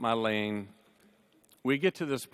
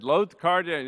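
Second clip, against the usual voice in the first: higher, louder bossy order; mom's command; 5 semitones higher, 5 dB louder than usual. Retold or imitated. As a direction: bark it like the ultimate mom giving orders. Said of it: "she gave her husband instructions".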